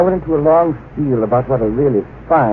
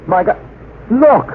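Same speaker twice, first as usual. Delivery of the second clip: much higher, louder sudden cry; startled exclamation; 9 semitones higher, 4 dB louder than usual. Acted. Secretary breaks off his retort, startled by the new moon. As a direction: gasp out the discovery.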